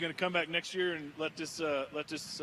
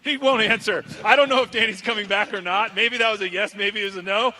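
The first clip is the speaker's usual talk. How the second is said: loud crowd announcer; 3 semitones higher, 9 dB louder than usual. Real, not acted. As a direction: call it out to the crowd.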